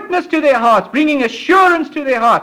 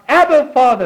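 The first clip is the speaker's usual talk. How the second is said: raised, fervent call to God; crying out; 5 semitones higher, 3 dB louder than usual. Acted. Preacher voices his own spirit calling God, crying out. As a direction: lift the pitch and call out. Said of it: "There's my spirit inside crying out".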